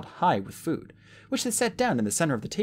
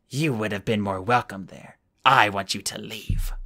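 put-on stern old woman; 3 semitones lower than usual. Old woman rejects Flamel.

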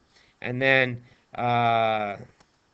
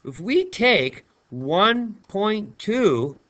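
slow emphasis; 6 semitones higher, much slower than usual. Shown as a demonstration. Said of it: "teacher slows to stress".

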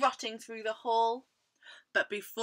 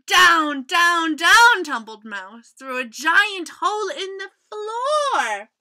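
loud, higher, dramatic narration; animated children's storytelling; 6 semitones higher, 15 dB louder than usual. A narrator's reading. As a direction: tell the tumble with big excitement. Animated reading of the fall.

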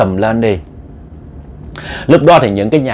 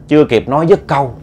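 usual speaking voice

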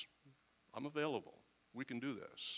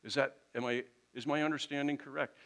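higher, louder puzzled questioning; raised-pitch, confused but willing asker; open inquiry; 6 semitones higher, 5 dB louder than usual. Acted. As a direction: act out someone earnestly checking their understanding. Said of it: "if you come with that attitude of".

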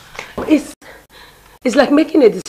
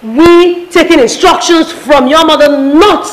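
raised, higher voice in indignant protest; angry quarrel; 4 semitones higher, 6 dB louder than usual. Acted. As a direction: fire back at your fiancé, loud and fed up. Fiancée quarrels over his mother's control.

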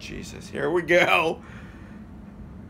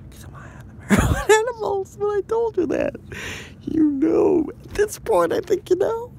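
high silly voice. Acted. High voice again.

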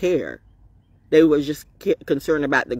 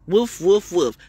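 woof woof like a playful dog; barking dog; slower than usual. Pretend. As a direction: imitate a dog barking lightly. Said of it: "The dog barks".

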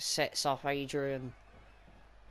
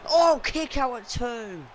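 surprised shout; 8 semitones higher, 9 dB louder than usual. Real, not acted. Shocked at a kick-out.

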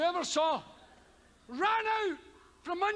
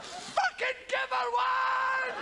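heckling shout; 11 semitones higher, much slower than usual. Real, not acted. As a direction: bellow at the screen. Heckling the film hero.

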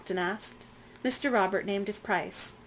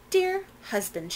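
sugary sweet; 11 semitones higher than usual. A narrator's reading. Madam sweetly asks husband.

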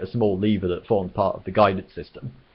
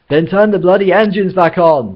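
bellowed order, much higher and louder; bawling; 10 semitones higher, 9 dB louder than usual. Acted. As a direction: bawl it at the computer in panic. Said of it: "bawled Zaphod".

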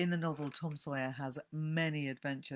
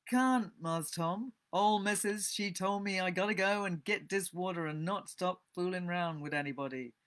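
put-on Southern dialect accent; 5 semitones higher, 5 dB louder than usual. A narrator's reading. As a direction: refuse in an old Southern American dialect accent. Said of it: "Sorry about the accent".

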